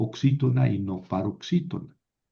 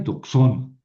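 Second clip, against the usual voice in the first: doxón with a stressed, louder -ÓN; stress on last syllable; 4 dB louder than usual. Shown as a demonstration. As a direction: hit the final syllable of doxón. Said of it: "cae en la última sílaba". (doxón con acento marcado en la última sílaba)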